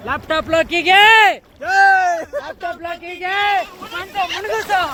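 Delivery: teasing call. Real, not acted. Teasing call to friend in water.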